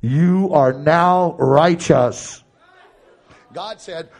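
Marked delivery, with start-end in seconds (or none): 0.0-2.4 slow, loud thundering voice like Niagara Falls; drawn-out, booming divine pronouncement; booming voice of God. Acted. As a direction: let God's voice roar like a waterfall. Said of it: "God said in a voice that sounds like Niagara Falls".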